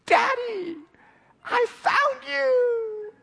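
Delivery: put-on very high, drawn-out squeal of a little girl; a delighted small child at last finding her dad; joyful child. Little daughter finds her father and squeals with joy, very high and slow.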